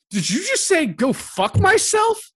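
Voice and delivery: very high, loud, slow indignant squeal; squeaky outraged voice. Retold or imitated. Friend imitating an outraged man, very high squealing. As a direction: squeal it in shocked disbelief. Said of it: "He's like".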